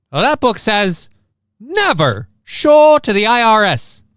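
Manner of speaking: slow, high, loud comic pronouncement; put-on comic voice. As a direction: read the book out in a drawn-out character voice.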